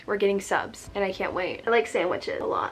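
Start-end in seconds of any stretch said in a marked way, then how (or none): 0.1-1.6 much softer, said to herself; quiet repeat. Real, not acted. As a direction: murmur it a second time, almost aside. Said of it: "I'm excited".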